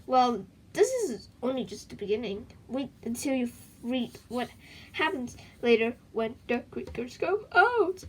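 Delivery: plain reading voice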